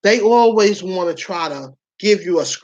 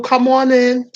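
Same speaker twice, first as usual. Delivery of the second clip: ordinary talk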